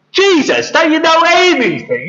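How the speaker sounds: high-pitched, incredulous reproach; scoffing. Acted. Peter scoffs at Jesus's fishing idea, incredulous. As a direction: scoff as if it's obvious.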